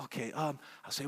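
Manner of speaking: usual speaking voice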